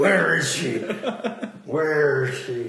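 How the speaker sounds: laughing mock Batman growl; hammy Batman demanding answers. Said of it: "Batman voice".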